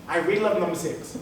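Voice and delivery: usual speaking voice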